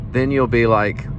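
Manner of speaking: normal talking voice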